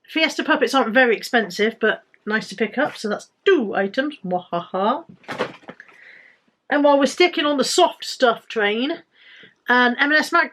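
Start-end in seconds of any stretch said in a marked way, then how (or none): 4.2-5.1 mock evil laugh. Gloating over items found.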